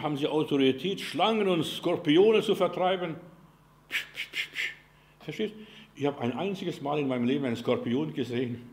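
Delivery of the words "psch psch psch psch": sharp repeated "psch" bursts; shooing hiss. Shown as a demonstration. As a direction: shoo vermin away in voiceless puffs. Macht das Vertreiben von Skorpionen vor. (stimmloses, stoßweises Verscheuchen)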